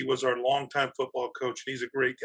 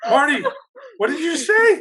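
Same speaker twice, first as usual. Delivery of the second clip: high, loud, laughing incredulity; stunned disbelief; 14 semitones higher, 8 dB louder than usual. Retold or imitated. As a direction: call out to a colleague, scandalized and amused. His stunned reaction to Marty's remark.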